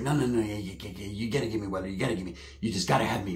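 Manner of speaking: low, very soft imitated cousin; hushed mock begging; pleading cousin. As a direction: plead under your breath as the cousin. Plays his pleading cousin.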